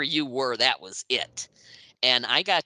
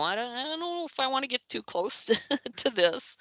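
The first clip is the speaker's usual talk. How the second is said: high, hesitant voice breaking into a laugh; mock intimidated; 11 semitones higher than usual. Young journalist backing away from intimidating rockers, mock nervous. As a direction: play nervously backing off, pitched up. Acting out being intimidated by the band.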